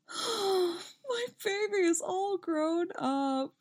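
gasp, then high, slow, tearful mom; wistful. A narrator's reading. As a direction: choke up with motherly pride, drawn out. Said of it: "sounding wistful".

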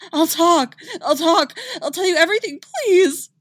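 high, loud begging scream; screaming in pain. A narrator's reading. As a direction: scream and beg for mercy. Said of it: "Sarkul screamed".

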